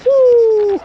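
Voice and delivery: very high, loud joyful whoop; excited whoop. Real, not acted. Showing off his Raptors jersey, a loud high whoop. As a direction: let out a big excited woo.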